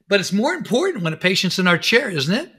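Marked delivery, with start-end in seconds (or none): none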